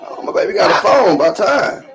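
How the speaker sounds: loud, high burst of delight; proud excitement. Real, not acted. Daughter just got a phone.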